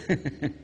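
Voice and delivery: quick real chuckle; short laugh. Real, not acted. Preacher to the crowd, chuckling at his joke. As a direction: laugh at your own joke.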